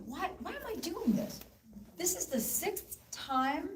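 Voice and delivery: acted-out exasperation, pitched high; high-pitched, mock-frustrated inner voice; exasperated self-questioning. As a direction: imitate someone fed up with themselves. Fed-up sick daughter's thoughts, acted.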